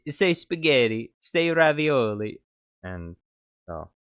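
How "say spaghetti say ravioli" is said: higher, louder mock Italian, slow at the end; teasing pasta words in Italian accent. Older cook teasing the kid with pasta words, mock Italian accent. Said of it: "then he would say".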